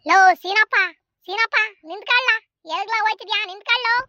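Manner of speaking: loud hail to someone walking off; urgent call across a distance. Acted. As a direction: shout after him to stop. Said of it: "ನನ್ನನ್ನ ಹಿಂಗ್ ಕೂಗ್ತಿದಾರಲ್ಲ".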